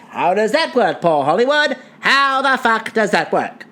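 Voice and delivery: put-on declamatory old-time newsreel voice; theatrical vintage radio delivery; twenties announcer. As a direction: announce the results like a 1920s broadcaster. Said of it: "do the judging in my nineteen twenties voice".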